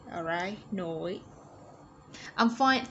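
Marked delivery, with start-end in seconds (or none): none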